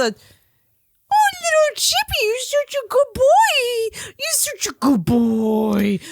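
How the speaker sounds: put-on pet baby talk. Shown as a demonstration. Woman showing how men talk to their dogs, high-pitched baby talk. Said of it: "baby talk to their pets or their animals".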